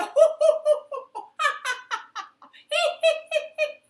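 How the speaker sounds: high, loud helpless laughing; hard laughter. Acted. Princess laughing so hard, high helpless laughter. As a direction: laugh till you can't stop. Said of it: "she was laughing so hard".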